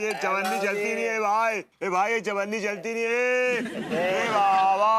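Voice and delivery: high, drawn-out beggar's call; loud begging cry. Acted. Beggar pestering people for money, loud singsong cry. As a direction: wail for coins at full volume. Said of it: "थोड़ा धीरे बोलो".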